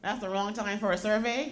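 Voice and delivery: ordinary talk, nothing marked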